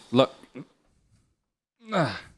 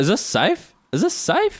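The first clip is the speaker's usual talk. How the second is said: worried question climbing sharply higher; retold alarm; 9 semitones higher than usual. Recalling the makeup session to a friend, mock-alarmed. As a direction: ask twice, pitch leaping on the repeat.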